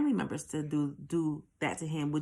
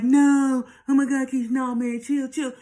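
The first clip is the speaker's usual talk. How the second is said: loud, raised-pitch mock panic; mock panicked pleading; 6 semitones higher, 7 dB louder than usual. Companion begging her to stop before a fight, mock panicked pleading. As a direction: imitate a scared friend begging her to calm down.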